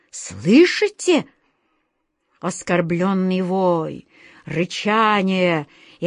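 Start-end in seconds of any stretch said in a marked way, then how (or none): 0.1-1.3 high, stretched-out challenge; threatening. A narrator's reading. Wizard warning the caged beasts, threatening. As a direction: draw the question out sharply upward. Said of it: "угрожающе прошипел волшебник Алёша".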